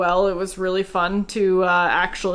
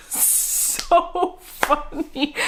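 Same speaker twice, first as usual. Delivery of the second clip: high, slow, squeezed out through laughter; laughing; 9 semitones higher, much slower than usual. Real, not acted. Vlogger tickled by the audiobook accent, laughing. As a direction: struggle to speak while laughing.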